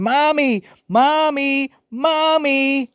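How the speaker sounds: shrill high screams for Mommy; screaming. A narrator's reading. Frightened girl running upstairs, screaming for Mommy. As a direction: scream for your mother.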